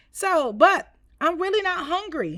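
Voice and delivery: put-on a small boy pleading he isn't hungry; boy's protest. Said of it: "he said".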